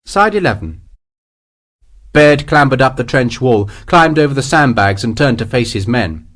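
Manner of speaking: plain reading voice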